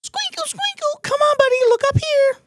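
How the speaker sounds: put-on squeaky coo to get a baby's attention; high baby-coaxing voice. Photographer cooing up high to make the baby look.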